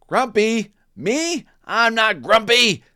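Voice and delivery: indignant Jim. Acted. Jim insisting to friends, high and loud. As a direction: protest hard you're not grumpy. Jim denies again, louder.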